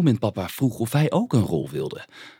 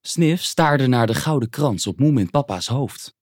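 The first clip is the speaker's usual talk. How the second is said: usual speaking voice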